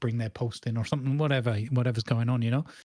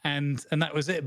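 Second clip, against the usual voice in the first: ordinary talk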